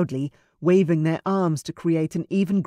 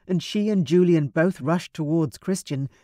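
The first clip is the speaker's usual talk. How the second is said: plain reading voice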